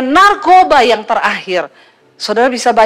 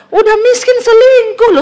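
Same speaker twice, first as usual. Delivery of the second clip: much higher, louder scornful cry; exasperated outburst; 12 semitones higher, 4 dB louder than usual. Real, not acted. Preacher scoffs at broke husbands who still cheat, high and loud. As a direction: hit the outrage hard.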